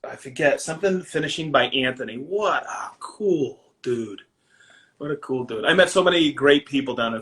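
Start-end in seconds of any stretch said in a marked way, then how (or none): 2.2-4.2 breathy hushed whisper, slow and drawn out. Real, not acted. Admiring a skilled finisher, whispered slowly. Fond repeat about a finisher.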